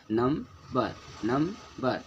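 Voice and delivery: Number slowly, first syllable held longer; number broken into two beats, weight on the first; stressed first syllable. Shown as a demonstration. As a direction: linger on नं, shorter बर. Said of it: "पहले इसको पहले थोड़ा देर के लिए".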